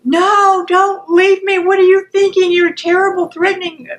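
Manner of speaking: high, loud panicked protest; escalated outburst. Acted. First person begs the partner not to leave, frantic. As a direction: cry out in alarm and accusation. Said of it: "And it escalates".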